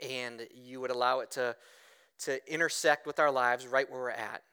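low, soft praying voice; hushed, low pastoral prayer; earnest prayer. Real, not acted. Leads the congregation in prayer.

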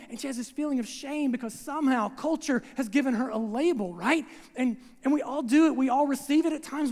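normal talking voice

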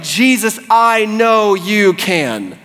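high, emphatic conviction; certain faith. Acted. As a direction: build to a slow, sure final declaration. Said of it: "I'm not wondering, I'm not saying maybe".

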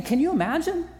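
ordinary talk

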